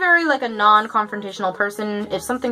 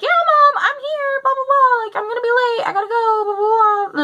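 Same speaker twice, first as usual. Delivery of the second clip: much higher, louder, whiny fake phone voice; fake phone call; 13 semitones higher, 3 dB louder than usual. Faking a call with mom to dodge the driver, high whiny voice. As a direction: sell a fake call to escape him. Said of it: "pretending like I'm on the phone with my mom".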